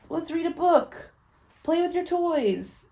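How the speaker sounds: high, feeble voice from the couch; sickly mother urging play from the couch; weak sick mom. Retold or imitated. Mother on the couch telling her daughter to play, weak and high. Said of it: "I was on the couch like this".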